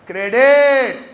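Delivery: a long, loud, sung-out "credit" with a falling end; a loud chanted "credit"; in rhythm and tune. Shown as a demonstration. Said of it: "सुर ताल में".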